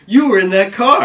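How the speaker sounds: loud, high-pitched mock cop yell; accusing shout. Cop rounding on a kid, shouting an accusation. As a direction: hurl the accusation, imitating a cop.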